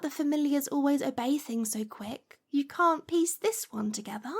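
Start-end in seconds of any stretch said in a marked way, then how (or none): none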